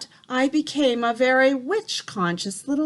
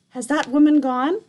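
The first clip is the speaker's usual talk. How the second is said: higher, louder anxious call; shouted question; 4 semitones higher, 6 dB louder than usual. A narrator's reading. Boy shouts down asking grandmother about the witch. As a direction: call it down, worried. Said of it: "I shouted".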